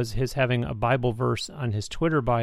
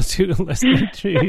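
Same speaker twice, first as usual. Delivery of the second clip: emotional laugh; 8 semitones higher, 6 dB louder than usual. Real, not acted. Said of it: "I'm getting emotional".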